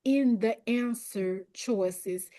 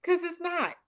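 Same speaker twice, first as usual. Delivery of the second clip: sassy self-talk; 8 semitones higher than usual. Retold or imitated. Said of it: "just tell yourself".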